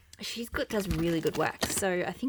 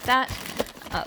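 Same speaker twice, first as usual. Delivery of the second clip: hiccup; 6 semitones higher, 4 dB louder than usual. Real, not acted. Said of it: "got the hiccups".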